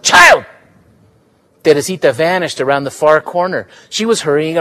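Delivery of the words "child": high, loud yell across the yard; a loud, anxious yell. Acted. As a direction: call her back sharply from the gate. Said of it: "She went to the gate and yelled".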